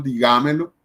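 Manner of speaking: "digámelo" con la fuerza en GÁ; acento mal puesto. Shown as a demonstration. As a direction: carga el acento en la segunda sílaba de "digámelo". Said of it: "No decimos". ("digámelo" mal acentuado, di-GÁ-me-lo)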